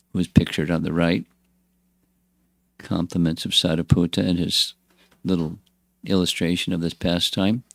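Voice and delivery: ordinary delivery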